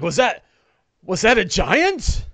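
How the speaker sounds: high, loud, incredulous question; Don John. Acted. Onlooker asking about the fleeing ogre, high astonished voice. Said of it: "Don John's like".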